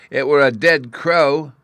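put-on loud, high childish insistence; slow, emphatic little-boy protest; persistent. A narrator's reading. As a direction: dig in like a stubborn child. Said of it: "Bruno persisted".

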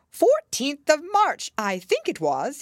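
high, slow Hatter voice; a fussy hatter giving his date. A narrator's reading. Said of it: "he said".

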